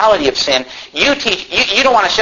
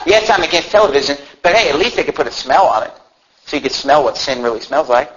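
usual speaking voice

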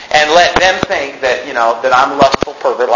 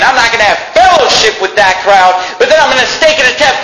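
raised, high-pitched pulpit declaration; forceful preaching; 8 semitones higher, 4 dB louder than usual. Real, not acted. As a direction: declare it firmly to the congregation.

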